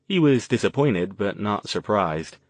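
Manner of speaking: usual speaking voice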